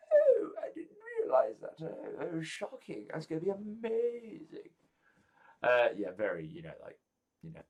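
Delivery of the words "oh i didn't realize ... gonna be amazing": very high, hammed-up delighted guest; Barbara. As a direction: squeal with wonder in a high falsetto. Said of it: "And Barbara's like".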